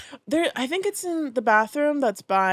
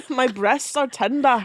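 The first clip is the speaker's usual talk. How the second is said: a light Scottish burr, slowed; hint of a Scottish accent; 4 semitones higher, slower than usual. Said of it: "That was Scottish".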